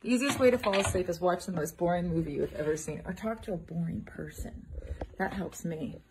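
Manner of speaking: ordinary delivery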